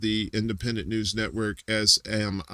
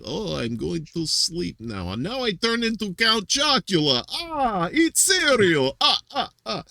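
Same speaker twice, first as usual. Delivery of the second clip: slow, much higher Russian-accented vampire; lazy Russian accent; 7 semitones higher than usual. Shown as a demonstration. Host showing off a Count Chocula bit, lazy Russian accent. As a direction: draw it out high and lazy, hammed-up Russian. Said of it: "a lazy Russian voice where it's like".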